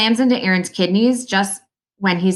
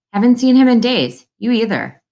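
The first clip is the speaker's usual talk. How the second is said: higher, louder opening, mildly pointed; a little accusing; 6 semitones higher, 5 dB louder than usual. A narrator's reading. Old man reproaches an absent visitor, a little accusingly. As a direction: lightly accuse the listener. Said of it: "he adds a little accusingly".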